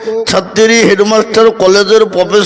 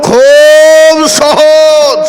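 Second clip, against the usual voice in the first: put-on cocky boy; 6 semitones higher, 5 dB louder, much slower than usual. Boy boasting a cocky answer.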